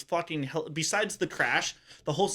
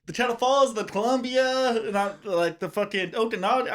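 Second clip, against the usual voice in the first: higher, lightly mocking stranger's reply; imitated campers; 6 semitones higher, 3 dB louder than usual. Campers answer the question about a river, mock-helpful. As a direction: list options in a raised quoting voice.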